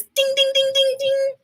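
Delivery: high sung ding-dings like a little bell; ding ding bell sound. Acted. Reading to kids, she chimes a high bell sound.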